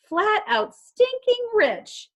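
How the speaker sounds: very high, slow, savoring squeal; gleeful. Acted. Izzy gloating to friends about riches, high and gleeful. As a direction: stretch every word out with glee.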